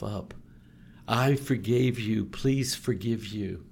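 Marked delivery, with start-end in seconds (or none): none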